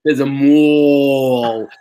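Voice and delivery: slow, drawn-out New York mall; New York accent. Shown as a demonstration. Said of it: "you say the word mall".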